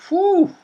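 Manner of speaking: high, loud whistled whew; exaggerated high exhale of relief; relieved whew. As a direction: imitate blowing out relief. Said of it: "really breathing heavy after".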